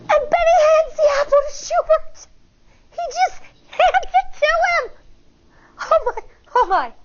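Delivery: can't believe it happened; amazed. A narrator's reading. Narrator marvelling that the bully gave in, astonished.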